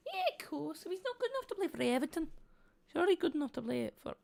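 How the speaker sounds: high, quick mock Liverpool accent that slides toward Irish; failed Scouser accent. Mocking Everton in a badly faked Scouse accent. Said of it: "tried to do a Scouser accent".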